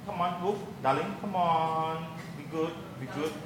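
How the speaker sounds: soft coaxing murmur; coaxing softly. Real, not acted. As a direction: gently urge it along. Coaxing device, darling.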